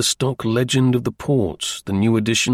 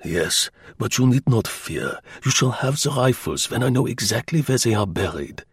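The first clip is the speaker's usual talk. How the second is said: put-on measured German-accented promise; reassuring German agent. German agent promising rifles to Slingsby, reassuring and accented.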